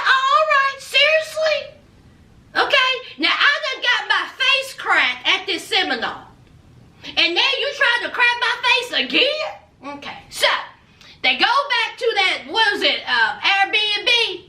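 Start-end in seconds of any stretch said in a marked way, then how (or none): 0.1-10.0 mock indignation. Acted hurt boyfriend's retort.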